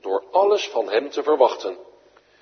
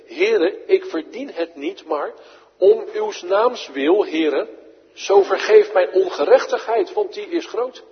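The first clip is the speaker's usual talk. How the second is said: David's plea; 6 semitones higher, 5 dB louder than usual. Acted. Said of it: "Zoals David deed in Psalm".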